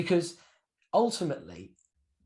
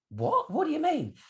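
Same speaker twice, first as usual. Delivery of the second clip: high, incredulous question; a sudden high leap of disbelief; retelling the nephew's shocked reply; surprised nephew; 12 semitones higher than usual. Retold or imitated.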